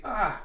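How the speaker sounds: sudden high, loud cry of pain; acted sharp "ah!"; pained yelp. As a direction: yelp as if yanked by the hair. Grabbed by the ponytail.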